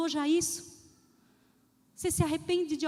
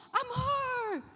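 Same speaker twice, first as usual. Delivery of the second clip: shout; 8 semitones higher than usual. Retold or imitated. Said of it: "E ele gritou".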